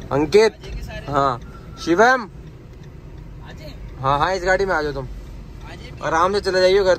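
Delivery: calling out to friends. Real, not acted. Telling friends which car to take home, calling out.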